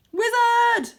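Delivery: very high, loud shout; loud high triumphant cry; excited shout. Acted. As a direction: shout it with delight.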